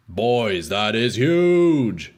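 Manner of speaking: slow, drawn-out hype; frat bro. Real, not acted. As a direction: stretch it out in awe. Hyped over a great roll.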